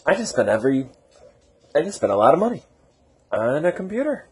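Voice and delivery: mock panic, pitch rising to a squeak. Telling viewers how he panicked over the computer, rising mock panic. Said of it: "I'm like".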